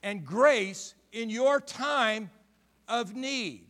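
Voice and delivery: loud, high-pitched preaching at full force; fiery pulpit preaching. Acted. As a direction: preach it out to the congregation.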